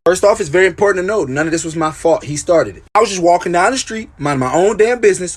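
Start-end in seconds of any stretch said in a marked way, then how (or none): none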